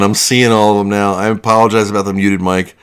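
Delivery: ordinary talk, nothing marked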